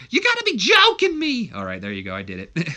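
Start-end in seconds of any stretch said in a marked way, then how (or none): 0.1-1.5 shrieked far higher and louder; a hammed-up screeching catchphrase; Billy Kay impression. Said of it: "We gotta do the Billy Kay".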